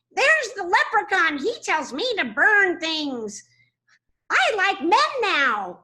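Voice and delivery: high, slow, odd little leprechaun. Shown as a demonstration. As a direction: play a babbling leprechaun. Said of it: "he's so, so fun to do".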